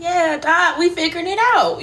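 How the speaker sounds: high, loud, excited reenactment; jubilant, quick high cheer; celebrating. Retold or imitated. Acting out celebrating with the doctor.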